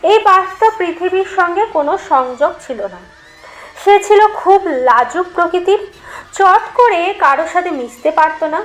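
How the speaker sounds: plain reading voice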